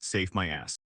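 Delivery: low, soft, scornful mutter; disgusted thought. A narrator's reading. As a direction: sneer it quietly under your breath. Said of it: "thinking in utter disgust".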